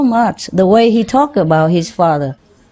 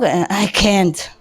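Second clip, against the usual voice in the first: ordinary talk, nothing marked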